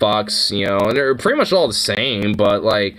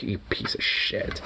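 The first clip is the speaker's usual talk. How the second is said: hushed whisper; whispered insult; 2 semitones lower, 11 dB quieter than usual. Retold or imitated. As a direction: whisper it under your breath. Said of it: "I'm like".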